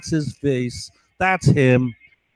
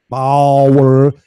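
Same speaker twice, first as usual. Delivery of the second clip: mock calling out; 2 semitones lower, 7 dB louder than usual. Man taunts his unseen watcher, calling out. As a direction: boom the name out, stretched. Drawn-out mock call of a name.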